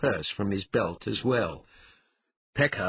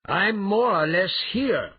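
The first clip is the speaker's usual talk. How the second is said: loud, high, drawn-out answering call; calling back; 12 semitones higher, 6 dB louder, slower than usual. Acted. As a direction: sing it out to someone at a distance. Calling back to roaring brute.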